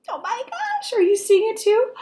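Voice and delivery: ordinary talk, nothing marked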